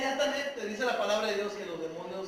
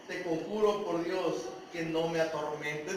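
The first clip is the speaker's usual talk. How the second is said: low, softened imitation of begging; retold plea; 7 semitones lower, 5 dB quieter than usual. Demon begs Jesus not to torment him, retold as a low plea. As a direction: plead quietly, as if quoting someone. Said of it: "Fíjense lo que está pidiendo".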